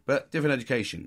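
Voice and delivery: ordinary talk, nothing marked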